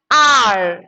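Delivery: "R" (hour) with no H, long falling vowel; silent H. Shown as a demonstration. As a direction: drop the H and stretch "R". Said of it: "You have to pronounce it as R".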